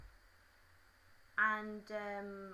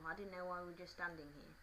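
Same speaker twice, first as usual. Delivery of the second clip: usual speaking voice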